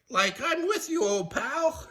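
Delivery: very high, cracking goofy voice; Shaggy agreeing. Acted. Shaggy agreeing to flee with Scooby, high panicky voice. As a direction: agree in a high cartoon squeak. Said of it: "agreed Shaggy".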